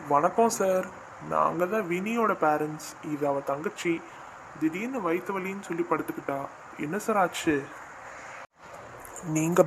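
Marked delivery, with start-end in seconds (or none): 0.1-7.6 much softer, timid parent to doctor; meek and quiet. Acted. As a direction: murmur the explanation respectfully. (டாக்டரிடம் பணிவாக மெதுவாக பேசும் பெற்றோர்)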